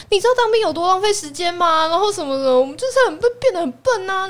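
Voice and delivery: high, loud, fast mock rant; mocking ex's indignant rant, high and fast; self-righteous ex. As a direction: mimic his smug justification. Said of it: "他还振振有词哦".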